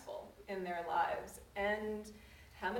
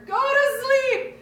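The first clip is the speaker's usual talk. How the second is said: screamed very high and loud, slow, voice cracking; crying yell; 19 dB louder, much slower than usual. Retold or imitated. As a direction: scream it through tears, at the end of your rope. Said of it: "I'm crying and yelling at her".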